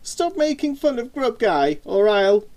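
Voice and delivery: put-on high, rising-tempered boy's protest; frustrated. A narrator's reading. Boy fed up with sister's teasing, frustrated. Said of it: "Simon's frustration got the better".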